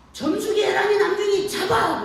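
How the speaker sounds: put-on high, loud call to the children; bellowed order to grab hold; father's shouted order. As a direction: shout the names, then draw out the command. Father calls children to hold her.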